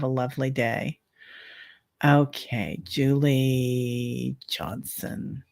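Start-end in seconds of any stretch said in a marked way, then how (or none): 2.8-5.4 slow breathy whisper of the name. Shown as a demonstration. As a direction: breathe the name out slowly, almost silent. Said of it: "whispers the name while drawing".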